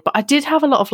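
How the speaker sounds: ordinary delivery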